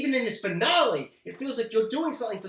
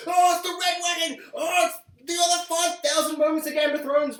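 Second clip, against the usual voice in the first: higher, louder excited gushing; hyped fan cries; 9 semitones higher, 4 dB louder than usual. Retold or imitated. Fans reacting to a big TV twist, shouting excitedly. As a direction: squeal like a thrilled fan. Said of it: "we were all be like".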